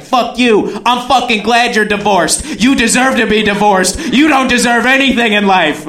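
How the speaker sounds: high, rapid-fire mock tirade; flipping out. Date turning on the divorced man, acted angry outburst. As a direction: imitate a date hurling insults. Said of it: "And then she flips it".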